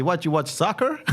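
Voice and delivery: hammed-up, muddled attempt at an American accent; dodgy put-on accent. Mimicking police at the fan's door, botched American accent. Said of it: "Or whatever accent that was".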